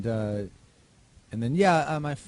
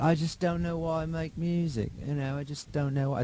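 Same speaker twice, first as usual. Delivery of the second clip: bad British accent, pitched higher and louder; terrible British accent; 8 semitones higher, 6 dB louder than usual. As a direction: ham up a British rock star's accent. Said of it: "It's my terrible British accent".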